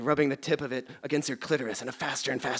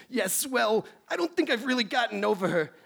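high, nasal-free nerdy professor; nerdy scientist voice; 8 semitones higher than usual. Acted. Plays Beekman in high voice.

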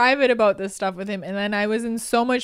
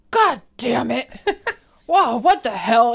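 loud, pitched-up mock frustration; hammed-up exasperated swearing; toddler imitating dad; 3 semitones higher, 5 dB louder, slower than usual. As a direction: curse like a tiny frustrated dad. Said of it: "dead serious 'cause he's imitating my dad".

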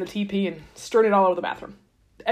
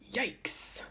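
breathy whisper, much softer; whispered yikes; 17 dB quieter than usual. Real, not acted. Host reacting to one tribe left, whispered. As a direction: whisper it in dismay.

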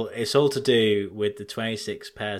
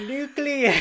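triumphant shout; 12 semitones higher than usual. Real, not acted. Finally blurting out the word.

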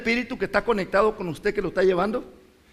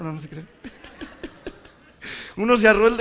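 ordinary talk

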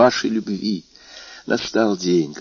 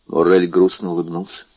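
ordinary delivery, nothing marked

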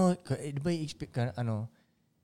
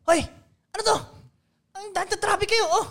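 higher-pitched, put-on scolding of the traffic; mock annoyed rich driver; 11 semitones higher, 3 dB quieter than usual. Rich man stepping out of his car, scolding, mock annoyed. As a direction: pretend to be irritated at the crowd.

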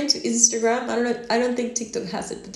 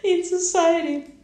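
laughing; 7 semitones higher, 5 dB louder than usual. Real, not acted. Laughs at own remark.

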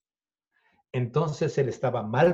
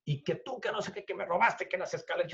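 soft, higher-pitched garbled imitation; mumbled mock rant; 5 semitones higher, 8 dB quieter, faster than usual. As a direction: mimic his jumbled complaining under your breath. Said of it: "hablaba como que tenía papas en la boca". (imitación de reclamo atropellado, bajito)

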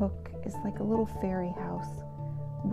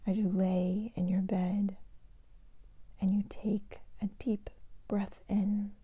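sleep meditation whisper; 5 dB quieter than usual. Shown as a demonstration. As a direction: whisper them gently toward sleep. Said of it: "I'd like to share a meditation with you today".

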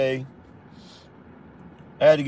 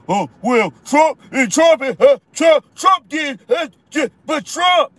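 mocking commenter; 8 semitones higher than usual. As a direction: parody a commenter stammering Trump. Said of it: "they love to come to my channel ... and say".

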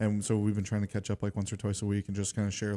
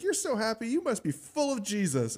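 delighted exclamation; 13 semitones higher than usual. Retold or imitated. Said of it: "And she's like".

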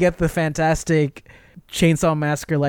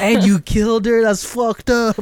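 higher, louder scandalized judge; imitated disbelief, pitched up; mock shock; 8 semitones higher, 3 dB louder than usual. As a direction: act out an appalled reaction. Acting out the shocked judges' reaction.

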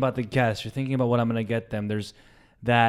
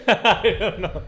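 laughing; 10 semitones higher, 4 dB louder, slower than usual. Real, not acted. Laughing at the story.

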